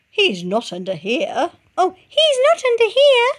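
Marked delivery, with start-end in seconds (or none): 1.8-3.3 very high, louder chick; squeaky chick correction; mother redoes the line in the chick's voice. Shown as a demonstration. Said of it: "That's Chicken Licken speaking".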